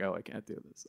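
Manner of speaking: defeated mumble. Retold or imitated. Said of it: "it was like".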